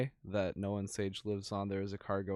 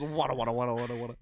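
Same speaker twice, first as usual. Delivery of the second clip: enthusiastic babbling; 4 dB louder, much faster than usual. Retold or imitated. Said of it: "He just goes".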